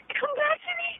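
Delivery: high, soft, pleading wife voice; hammed-up movie deathbed plea, high and faint; mock grieving wife. Wife to dying husband in a movie, mock tearful plea. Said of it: "guy is dying and his wife".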